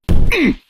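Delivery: loud, pointed throat clear to get attention. Acted. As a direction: clear your throat loudly to stop them. Arkin interrupts their exit.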